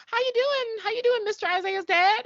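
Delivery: high, sweet, put-on innocent voice; awkward fake innocence. Teens caught by the dad, fake sweet and high. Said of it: "play it off and, like, you know".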